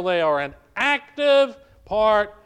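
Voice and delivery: "active" drawn out and punched loud; stress on ACTIVE. Real, not acted. Pastor urging real church involvement, stressing "active". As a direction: lean hard on the word "active". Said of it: "I stress the word active".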